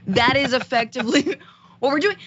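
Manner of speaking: words breaking into a laugh; laughing while talking. Real, not acted. Laughs at own absurd image.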